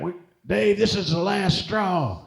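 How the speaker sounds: high, slow stagey western lawman; mock marshal. Comedian imitates the marshal actor confronting Ornery Dave, high stagey voice. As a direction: ham up the tired marshal's threat. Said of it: "he's like".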